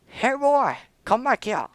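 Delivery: high, loud call after a runaway. Pretend. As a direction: shout after it like a lost dog. Calling after runaway ship.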